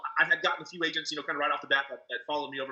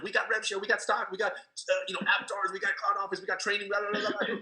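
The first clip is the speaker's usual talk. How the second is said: mock excited rush; 4 semitones higher than usual. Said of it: "I was so excited".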